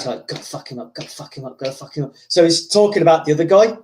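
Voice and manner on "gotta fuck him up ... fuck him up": low, very soft repeated mutter; muttering to himself. Retold or imitated. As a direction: imitate a fighter psyching himself up under his breath. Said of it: "he's saying to himself".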